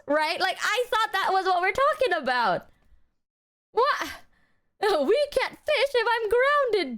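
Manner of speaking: exasperated protest. Retold or imitated.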